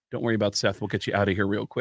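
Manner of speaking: low, quiet reassurance; lightly put-on low voice, softly brushing someone off; subdued, low-pitched retold reply; lightly voiced Mike Henry. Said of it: "who does the voice of Cleveland".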